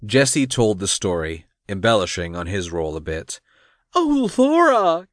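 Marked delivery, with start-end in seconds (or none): 3.9-5.1 high, drawn-out exclamation; high dramatic outcry; crying out. A narrator's reading. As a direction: cry out in a much higher, louder voice. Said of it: "Cassie cried".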